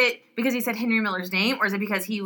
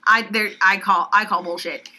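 ordinary talk

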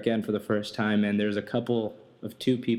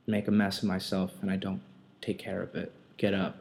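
normal talking voice

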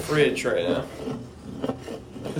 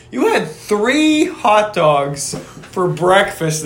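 "three" stretched out and punched; incredulous, drawn-out "three hot dogs"; slow emphatic stress; much slower than usual. Real, not acted. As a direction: spell it out slowly, leaning on "three". Mock confronting friend about breakfast.

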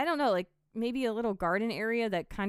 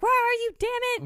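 much higher and louder mock yell; frustrated yelling; 7 dB louder, much faster than usual. Retelling her frustrated search for the villain, yelling. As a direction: imitate a high, fast, exasperated shout. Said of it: "I would start yelling for him".

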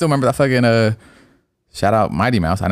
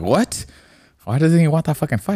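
higher-pitched, baffled questioning; retold disbelief; 9 semitones higher than usual. Host reacts to Whitaker turning down the fight, incredulous. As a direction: replay your baffled reaction, pitch jumping up.

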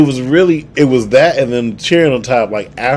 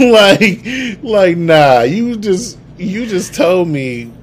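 laughing; 8 semitones higher, 5 dB louder, much slower than usual. Real, not acted. Laughing while retelling his reply.

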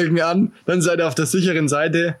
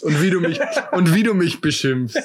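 amused, breaking into laughter; real laugh bubbling into the speech. Real, not acted. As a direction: laugh your way through the words. Laughs at being insulted.

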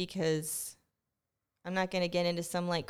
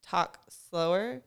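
drawn-out, slowed-right-down words; deliberately slower; much slower than usual. Shown as a demonstration. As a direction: stretch it out to slow yourself. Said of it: "I really need to try to".

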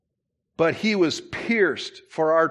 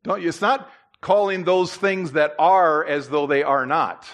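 ordinary talk

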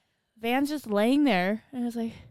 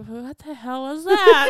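higher, louder, drawn-out disbelief; retold bewilderment, loud and stretched; baffled reaction; 5 semitones higher, 7 dB louder, slower than usual. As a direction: act out a slow, incredulous double take. Acting out a startled past reaction.

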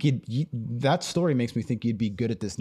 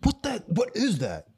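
high-pitched, puzzled disgust; imagined baffled reaction; 10 semitones higher than usual. Acted. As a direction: act out someone finding something nasty. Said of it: "somebody's gonna be like".

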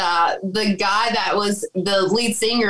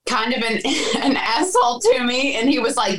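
ordinary delivery

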